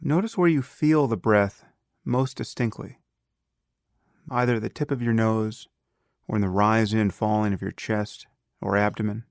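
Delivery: calm, unhurried meditation guide with long pauses; guided meditation. Acted. Teacher leading a beginner through breath awareness, calm guided meditation.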